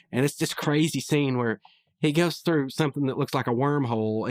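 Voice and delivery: ordinary talk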